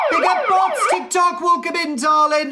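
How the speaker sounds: high, bright hype-man welcome; excited shout-out. Real, not acted. Streamer hyping up TikTok viewers, high excited greeting.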